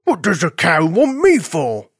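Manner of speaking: slow, loud, puzzled question; puzzled farm dog. Acted. Farm dog asking why the cow wants him, puzzled. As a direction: draw it out as a baffled dog.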